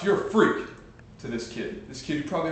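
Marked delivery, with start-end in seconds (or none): 0.0-0.7 a loud mock shout at a classmate; schoolkid's taunting yell; retold shout. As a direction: fling the insult across the classroom. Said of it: "he shouts".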